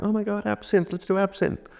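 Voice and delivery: imitating excited dinner guests; excited friends. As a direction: squeal it like giddy friends ordering. Friends excitedly ordering absinthe.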